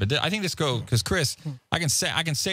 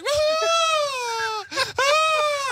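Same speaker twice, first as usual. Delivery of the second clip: mock cry of dismay. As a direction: howl like a kid losing a balloon. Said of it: "and I'm like".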